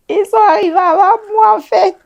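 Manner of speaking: high laughing burst through the words. Real, not acted. Laughing while telling the story.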